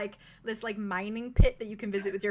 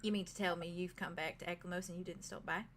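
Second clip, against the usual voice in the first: quiet teasing British innkeeper; British accent; 6 semitones lower, 13 dB quieter than usual. Acted. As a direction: chide softly in a British lilt. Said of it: "ignore me if I slip into a British accent".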